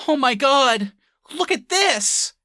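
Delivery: loud, high mock exclamation; hammed-up, drawn-out amazement in a high voice; excited calling out. Imitating a woman showing the diner her phone, excited.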